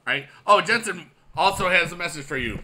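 normal talking voice

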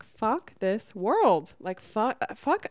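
loud, fed-up venting at the world; mock angry outburst. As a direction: blurt it out like you've had enough of everything. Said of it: "where I'm just like".

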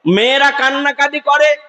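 ordinary talk, nothing marked

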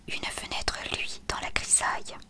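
usual speaking voice

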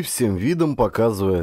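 ordinary delivery

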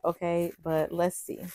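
ordinary delivery

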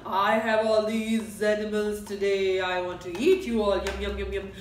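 loud, low greedy lion. Acted. Lion greeting the animals at his den, loud and greedy. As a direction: growl it out like a hungry lion.

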